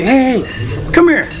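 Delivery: calling over. Pretend. Russian handlers summon him, calling out. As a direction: bark him over from across the room.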